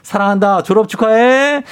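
excited congratulations. Acted. Host reads a parent's message to daughter.

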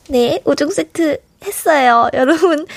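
ordinary delivery

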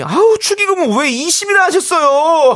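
mock exasperated listener. Host reading listener comment.

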